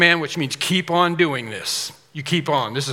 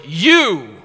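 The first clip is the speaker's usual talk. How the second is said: You said loud and ringing; single them out on You; emphatic You; 2 semitones higher, 9 dB louder than usual. Retold or imitated. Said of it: "the you is stated".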